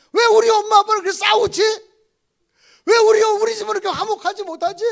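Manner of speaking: loud, indignant string of questions; a child's loud protest against the parents; child's complaint. Retold or imitated. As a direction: fire off the grievances at full voice. Children's complaints voiced loudly.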